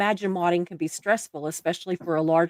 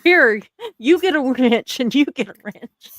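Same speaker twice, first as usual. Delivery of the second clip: playfully louder, higher hand-out call; mock giveaway announcement; 5 semitones higher, 4 dB louder than usual. Host jokes about handing out wrenches to chat, playful announcement. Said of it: "I'm just like".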